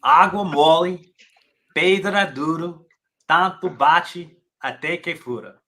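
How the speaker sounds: an American reciting a Portuguese proverb, careful and slow; Portuguese proverb read slowly in an American accent. Shown as a demonstration. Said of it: "excuse my American accent".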